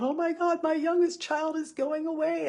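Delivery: high, swooping tragic wail; melodramatic lament. Retold or imitated. Mother at taco time voicing her spiraling dread, high melodramatic wail. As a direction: ham up the heartbroken mother. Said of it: "I started thinking about".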